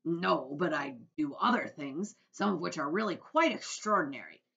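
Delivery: loud, brash creature voice at normal pitch; centipede voice. A narrator's reading. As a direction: boast boldly as the centipede. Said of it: "I'm sorry, that was the Earthworm".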